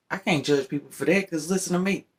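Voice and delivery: speaking in a put-on high voice; voice made high. Real, not acted. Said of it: "tried to make my voice high".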